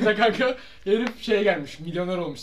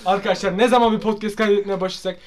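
loud, raised, hammed-up complaint; mock exasperation; 3 semitones higher, 12 dB louder than usual. Friend complaining to the group about insulted family, mock exasperation. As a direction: protest at full volume with laughter behind it.

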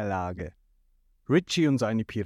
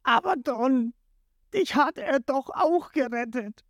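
high, wailing protest; desperate pleading; 10 semitones higher than usual. A narrator's reading. Jin appealing to his Don, high plea. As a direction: beg him to see reason.

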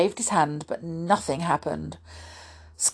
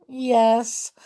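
miserably; 6 semitones higher than usual. A narrator's reading. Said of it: "said the boy miserably".

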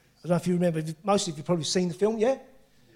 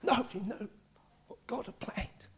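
awed whisper; 3 semitones higher, 8 dB quieter than usual. Real, not acted. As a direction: drop to a whisper as if revealing a secret. Repeats the proclamation in hush.

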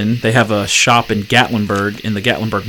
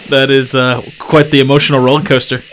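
usual speaking voice

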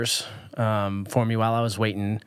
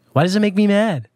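higher and louder, real frustration; exasperated outburst; 6 semitones higher, 5 dB louder, faster than usual. Real, not acted. Asking herself why drivers anger her, exasperated and louder. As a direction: throw up your hands at yourself. Venting about other drivers' cutting in.

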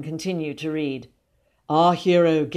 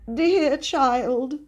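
high-pitched affected cousin; lightly voiced elderly lady, pitched high; murmured greeting; 9 semitones higher than usual. A narrator's reading. Elderly cousin greets the boy, murmuring. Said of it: "she murmured".

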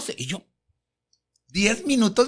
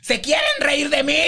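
higher-pitched mock outrage; indignant yell; 6 semitones higher than usual. Diva accusing the crew of mocking her, indignant yell. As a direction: imitate an offended diva's outburst.

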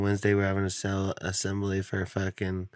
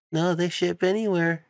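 chirpy sales pitch; 9 semitones higher than usual. Retold or imitated. Relatives told about shipping.

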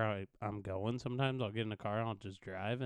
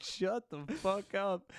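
higher-pitched, drawn-out mimicked outburst; retold outburst; 8 semitones higher, slower than usual. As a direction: imitate a parent's outburst, slowly and higher. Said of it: "when he's like".

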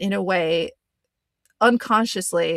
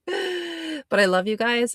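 sweet high plea; 6 semitones higher, slower than usual. Real, not acted. Playful affectionate plea.

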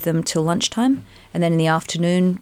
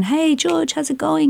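bright, much higher put-on cheerful greeting; breezy hello; 10 semitones higher than usual. Walking into the audition, greeting George with forced brightness. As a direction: breeze in as if nothing's wrong.

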